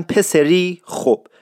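slow Isfahani examples like دختری گل and فیلمی قشنگ with added ی; Isfahani accent. Shown as a demonstration. Teacher demonstrating Isfahani adjectives to learners. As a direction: spell out each example in Isfahani, slowly.

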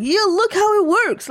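high, overexcited salesman pitch; infomercial voice. Retold or imitated. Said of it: "That sounds like an infomercial for an appliance".

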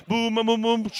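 loud booming beats; pew banging. Retold or imitated. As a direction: pound out each boom. Pews shaking.

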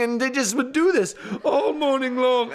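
plain reading voice

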